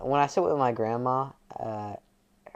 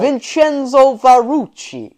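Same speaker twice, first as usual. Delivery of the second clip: much higher, loud, slow Italian flourish; hammed-up Italian surname, dragged out; mock Italian name; 13 semitones higher, 11 dB louder, slower than usual. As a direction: belt the name like a proud Italian. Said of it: "They'll be like".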